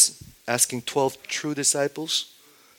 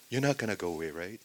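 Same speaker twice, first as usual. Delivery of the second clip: normal talking voice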